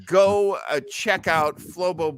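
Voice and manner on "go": ordinary delivery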